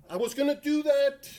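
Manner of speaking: tag protest. Acted. Protests about planned TikTok search.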